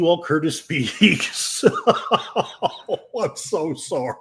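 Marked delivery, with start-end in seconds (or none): 1.7-2.8 sudden high laugh; a real laugh escaping; burst of laughter. Real, not acted. Chat joke about hot tea.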